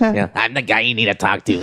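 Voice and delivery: a lightly voiced cocky promoter; cocky guy impression. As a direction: play the cocky guy who runs the show. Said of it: "pinche cocky motherfucker".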